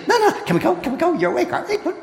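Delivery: a pushy little dog who won't let you sleep; dog insisting its owner is awake; eager dog. Acted. Dog voiced insisting owner wake.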